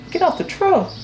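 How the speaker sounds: incredulous echo. Retold or imitated. Repeating a veteran driver's advice back to him in disbelief. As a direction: pitch shoots way up in disbelief.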